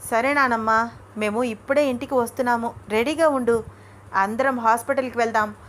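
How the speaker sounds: usual speaking voice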